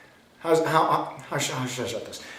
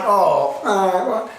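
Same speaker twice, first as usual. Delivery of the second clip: hammed-up hurt reaction, higher and louder; mock wounded; 7 semitones higher, 5 dB louder, much slower than usual. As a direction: play someone stung by criticism. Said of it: "be hurt or wounded".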